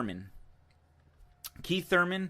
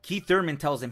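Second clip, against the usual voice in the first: usual speaking voice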